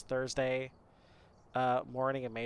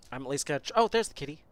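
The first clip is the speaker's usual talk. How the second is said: ordinary talk, nothing marked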